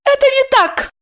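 high, sharp, clipped-off denial; curt retort. A narrator's reading. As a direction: cut her off firmly. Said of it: "отрезала Евдокия".

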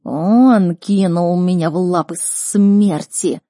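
slow, loud beast accusation; heavily drawn-out creature voice; Никс. Acted. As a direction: wail it out word by word like a wronged creature. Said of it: "продолжал Никс".

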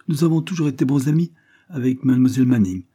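lightly voiced chatty boy; a touch of boyish eagerness; lively young boy. Boy chats with governess.

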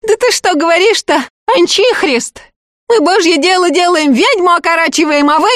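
a touch of an indignant peasant woman. Acted. Marya tearful protest.

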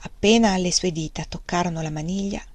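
usual speaking voice

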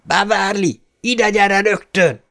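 high, slow commanding yell; bellowing father. A narrator's reading. Father bellows for his daughter from the bathroom, loud. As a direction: summon her like a drill order. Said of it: "torka szakadtából felordított".

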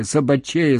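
ordinary delivery, nothing marked

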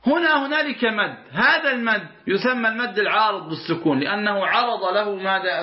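usual speaking voice

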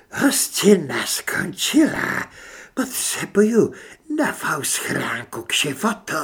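slow, high, strained monster voice; rasping creature. A narrator's reading. Parasite demands a new body from its victims, rasping. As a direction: rasp each word out slowly. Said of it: "Hlas skřípal, nebyl na rozhovory zvyklý".

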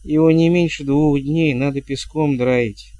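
ordinary delivery, nothing marked

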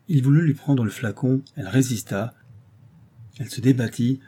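ordinary delivery

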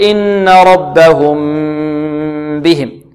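slow chanted recitation, long nasal hum on the م of ربهم; lengthened ghunna. Shown as a demonstration. Teacher models ikhfa, humming the م of ربهم long. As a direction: hold the m of ربهم before releasing into بهم. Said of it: "نطيل الغنة فيها".

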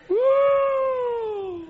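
"uuuh" largo y agudo; ulular agudo. Real, not acted. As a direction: suelta un grito agudo que va cayendo. Exclama tras la risa. (ulular sostenido de predicador)

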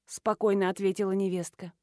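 plain reading voice

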